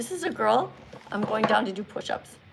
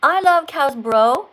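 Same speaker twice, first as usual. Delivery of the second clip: loud, emphatic rereading; deliberate retry; 8 dB louder, much slower than usual. Real, not acted. Teacher retrying a confusing viewer comment, loud and deliberate. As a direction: read it out again firmly and loudly. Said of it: "Let's t-, try this again".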